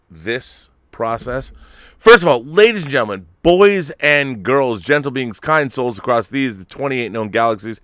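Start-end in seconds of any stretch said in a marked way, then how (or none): 2.0-7.8 big hype announcer welcome. Acted. Host announces guests on show.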